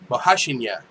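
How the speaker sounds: Bohashinya said as bo-ha-SHEEN-ya, falling; phonetic pronunciation. Shown as a demonstration. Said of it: "that's how they should spell it".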